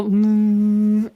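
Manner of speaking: long, steady monotone "mm"; imitated drone hum. Explaining what the mic picks up, droning hum. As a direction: hum a level drone noise.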